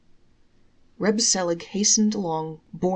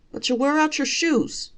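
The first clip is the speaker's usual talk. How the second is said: high, louder wife's protest; objecting wife; 9 semitones higher, 5 dB louder than usual. Acted. Wife protesting to her husband, high and louder. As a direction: push back in a high wife's voice.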